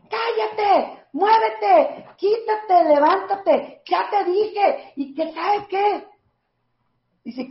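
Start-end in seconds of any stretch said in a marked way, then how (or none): 0.1-6.1 shouting mother. Retold or imitated. Child quotes mom yelling orders.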